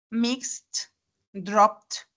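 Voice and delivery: mixed and dropped said as "mixt", "dropt"; T endings. Shown as a demonstration. Teacher lists mixed, dropped, -ed as T. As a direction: end mixed and dropped on a clear T. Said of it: "se va a pronunciar como T".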